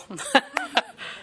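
high-pitched giggle; a quick amused laugh. Real, not acted. Preacher laughing about keeping the little girl at home.

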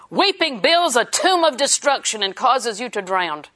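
ordinary delivery

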